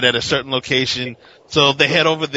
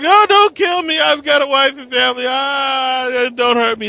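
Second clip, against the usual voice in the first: mock begging; 11 semitones higher than usual. Kidnapped doctor begging the villain, in high mock panic. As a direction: plead for your life, hammed up. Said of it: "and he's like".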